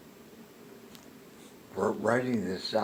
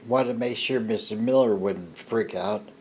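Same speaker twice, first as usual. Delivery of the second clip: normal talking voice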